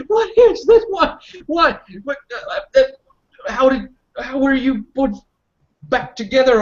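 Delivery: overjoyed. Acted. Stunned joyful reunion questions.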